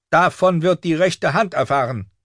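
high-pitched furious threat; outraged governess threatening to tell; raging septa. A narrator's reading. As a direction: rage through the closed door. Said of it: "tobte Septa Mordane".

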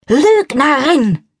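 high, drawn-out furious scream; enraged wife screaming at the narrator before attacking her; screamed accusation. A narrator's reading. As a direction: shriek it out, slow and enraged. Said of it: "schreit Elsa Blumenthal".

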